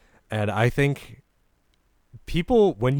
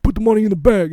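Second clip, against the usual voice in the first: much higher and louder put-on street accent, clearly mocking; hammed-up urban accent robber demand; 11 semitones higher, 10 dB louder, faster than usual.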